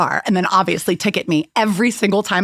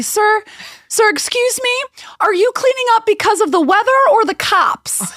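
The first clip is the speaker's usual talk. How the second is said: high-pitched, overly sweet call to a stranger; acted polite question; 12 semitones higher than usual. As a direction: reenact calling out sweetly, pitched way up. Acts out her polite question to vendor.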